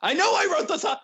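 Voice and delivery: loud, high-pitched exasperation; panicked protest, voice jumping up; flustered outburst. Real, not acted. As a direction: cry out in comic dismay. Flustered after forgetting own song.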